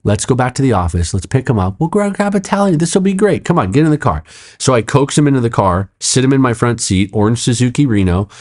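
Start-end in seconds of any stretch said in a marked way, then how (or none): 0.0-4.2 retold eager salesman pitch, climbing high; salesman mode. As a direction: lightly play a coaxing salesman. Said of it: "going salesman mode now".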